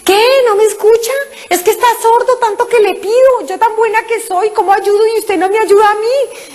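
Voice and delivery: high, huffy grumbling at God; indignant complaint. Retold or imitated. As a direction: sulk like a spoiled believer. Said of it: "Yo pensaba".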